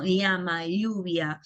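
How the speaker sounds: "Llama" and "lluvia" with the ll as a palatal lateral, ly sound; Andean ll. Shown as a demonstration. Teacher modelling the Andean ll for students, lateral ly. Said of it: "donde hay esta pronunciación".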